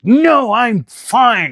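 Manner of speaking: high, loud through-the-teeth "I'm fine"; livid denial. Retold or imitated. To her co-pilot offering to drive, a strained high "no, I'm fine". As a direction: insist you're fine while obviously furious. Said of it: "I'm like".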